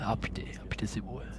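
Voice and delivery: whispered, hushed in wonder; awed whisper. Real, not acted. Gamer admiring the sea in a hushed whisper. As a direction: breathe it out softly, struck by the view.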